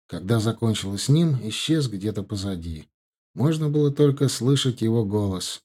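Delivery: ordinary delivery, nothing marked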